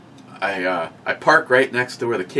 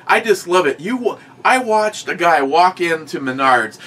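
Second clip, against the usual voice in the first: normal talking voice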